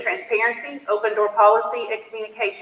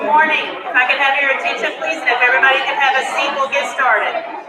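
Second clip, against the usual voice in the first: loud announcement; 5 semitones higher, 6 dB louder than usual. Real, not acted. Calling a crowd to order.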